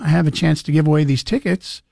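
ordinary talk, nothing marked

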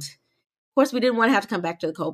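ordinary talk

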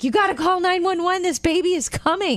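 high-pitched urgent plea; mock panic, pitched well up; retold urgency. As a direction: imitate a mother-to-be calling for help.

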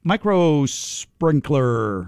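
drawn-out, word by word; slow. Acted. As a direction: stretch micro sprinkler slowly. Announcing the caller's answer.

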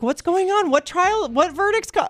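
high, baffled rising questions; confused surprise. Retold or imitated. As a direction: play clueless then catch on. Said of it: "And I was like".

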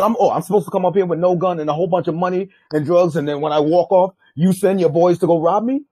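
indignant retold argument; even madder. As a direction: lay out the grievance heatedly. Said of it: "now I'm even mad".

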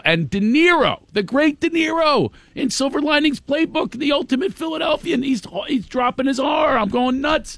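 high, rising exasperated rant; indignant rant. Real, not acted. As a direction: work yourself up over the dropped R. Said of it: "I do this rant".

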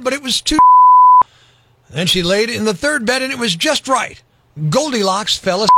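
lively read-aloud storytelling; story narration. A narrator's reading. Reading a story to kids.

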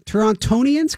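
"Torontonians" with the second T sounded; T pronounced. Shown as a demonstration. Asking if it's allowed, "Torontonians" with the T pronounced. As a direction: say "Torontonians" as a question, T after the N clearly said. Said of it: "Can I say that even though".

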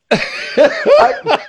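loud, high burst of real laughter breaking out. Real, not acted. Caught off guard by the joke about a shot, bursting out laughing.

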